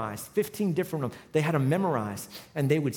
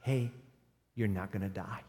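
quiet voice of God; 9 semitones lower, 9 dB quieter than usual. Retold or imitated. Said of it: "He said".

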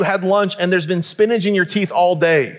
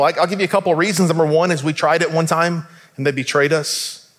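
ordinary talk

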